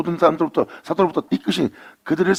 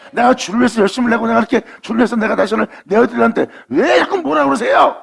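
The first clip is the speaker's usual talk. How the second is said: much higher and louder, whining protest; complaining believer; 11 semitones higher, 5 dB louder than usual. Acted. Preacher mimics a believer complaining to God, high whine. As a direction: plead your case as a wronged believer.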